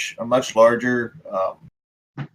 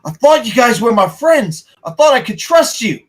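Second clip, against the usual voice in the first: high-pitched, wounded outburst; betrayed Coulson; 9 semitones higher, 3 dB louder than usual. Acted. Man turning on friends he trusted, hurt and angry. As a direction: throw the accusation at them, pitched high.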